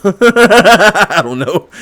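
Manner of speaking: helpless high laugh into the words; loud, high-pitched laughter. Real, not acted. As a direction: burst out laughing, then speak. Laughs over her own wrong guess.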